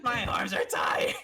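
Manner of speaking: slow, loud mock sob; mock crying whine. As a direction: wail it like a beaten kid, hammed-up. Comic whine after failing lift.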